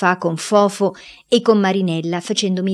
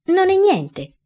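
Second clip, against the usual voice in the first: voce acuta da ragazzino che minimizza; voce di ragazzo; 12 semitones higher, 3 dB louder, slower than usual. A narrator's reading. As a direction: Gian Burrasca che fa l'innocente, più acuto. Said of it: "dissi io". (voce acuta da bambino che si giustifica)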